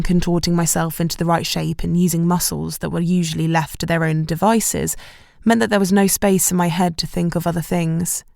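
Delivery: plain reading voice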